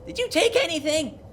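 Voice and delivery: very high, loud little accusing voice; girly high-pitched kobold. Acted. Little kobold confronting the stranger at her door, high-pitched loud accusation. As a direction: demand it in a girly squeal. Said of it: "high-pitched voice".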